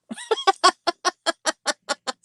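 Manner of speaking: real sheepish giggle; embarrassed laugh. Real, not acted. Laughing at herself being questioned.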